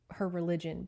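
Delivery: usual speaking voice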